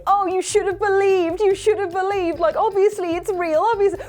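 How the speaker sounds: high, frantic inner voice of fear; panicked inner voice. Retold or imitated. The scared boy's own mind scolding him, high and frantic. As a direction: squeal it like rising panic. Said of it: "All of my creepypasta, like, scares flooding back".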